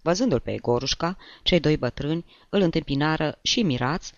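ordinary delivery

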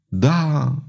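breathless eager yes; eager murmur. A narrator's reading. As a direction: murmur it with rising longing. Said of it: "murmură țiganca cu avânt".

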